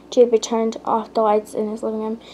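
ordinary delivery

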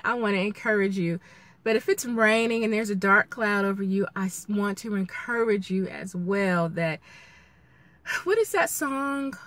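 ordinary delivery

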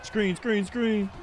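high, loud repeated warning; urgent call-out. Real, not acted. Calls out play during game.

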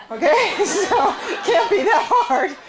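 high, loud, laughing through the words; real laugh bubbling through a remark. Real, not acted. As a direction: joke to the room, breaking into laughter. Laughs while joking to audience.

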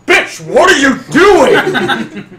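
high, loud, drawn-out scolding; exasperated yell. Acted. Game master scolding a wayward character, exasperated. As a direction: holler it in mock disbelief.

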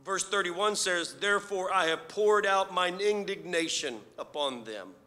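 rising preacher's fervor; preaching. Acted. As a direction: preach it with building force. Preaching builds in force.

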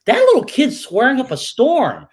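high, loud complaining teacher; teacher complaining to the parents, high and loud; exasperated teacher. Retold or imitated. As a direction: play the fed-up teacher calling home. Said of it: "What they were actually saying is".

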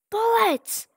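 high sudden blurt, caught out; blurted slip. Acted. Flower slipping up mid-pitch, a sudden high blurt. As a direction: blurt it, then catch yourself. Flowey slips, corrects himself.

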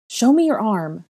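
loud high command. A narrator's reading. Girl demanding the boy bare his arm, loud. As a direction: order him to show it. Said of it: "I commanded".

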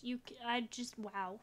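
ordinary talk

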